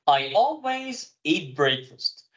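very slow, word by word; slow model sentence. Shown as a demonstration. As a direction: spell it out slowly for the class. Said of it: "يعني أنا دايمًا بتناول إفطار".

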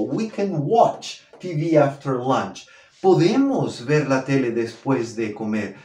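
ordinary talk

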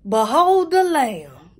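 slow, loud, drawn-out reveal; mock-grand proclamation. Vlogger unveiling her wig to viewers, mock-grand proclamation.

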